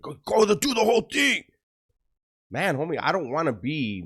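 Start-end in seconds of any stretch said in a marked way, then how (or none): 0.0-1.4 high, loud shouted demand; imitating fans yelling at him; mock fan shout. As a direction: shout like impatient viewers. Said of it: "you guys were like".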